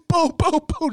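high, loud mock banging booms; banging noises. Showing how he beat the lantern to scare the moose, loud booms. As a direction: hammer out each boom.

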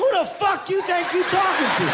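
very high, squawking mock shout; mock outraged retort. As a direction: play at being hugely offended, voice shot way up. Listener acts out outraged retort.

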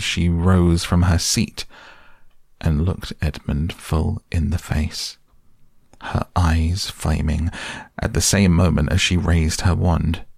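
ordinary delivery, nothing marked

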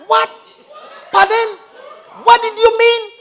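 high-pitched, acted incomprehension; baffled listeners. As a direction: play a baffled listener, rising to a loud "Oh". Listeners puzzled by the accent.

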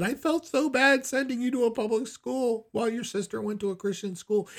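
high-pitched mock tearful wail; imitated crying mother. Tearful mother apologizing to her son about public school, mock wailing. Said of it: "And she's crying".

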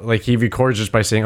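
ordinary talk

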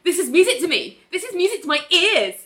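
high-pitched, giddy bravado; defiant glee. Retold or imitated. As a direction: sing out your defiance as you dance. Ali defiantly dancing to her heartbeat.

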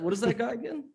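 usual speaking voice

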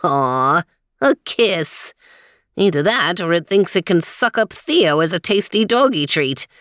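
lightly voiced old man, measured and helpful; a hint of a friendly old guide, unhurried; old man Weezer. Weezer comments on fish.

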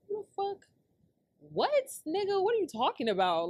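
high-pitched, retold indignant comeback; incredulous outrage. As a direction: shoot back in disbelief, voice jumping up. Acts out outraged reply to insult.

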